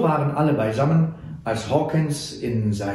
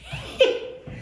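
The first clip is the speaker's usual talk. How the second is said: high giggle; 3 dB quieter than usual. A narrator's reading. Sam laughs at the worry.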